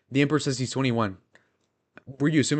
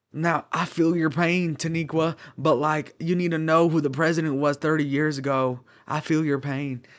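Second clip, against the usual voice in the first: Bill Clinton impression; 5 semitones higher than usual. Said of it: "Is that a good Bill, Bill Clinton impression?".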